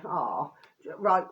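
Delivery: ordinary talk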